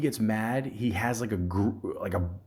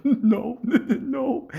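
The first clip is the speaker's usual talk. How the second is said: much higher, giggling through the words; a friend failing to hold back laughter; laughing no; 5 dB louder than usual. Retold or imitated.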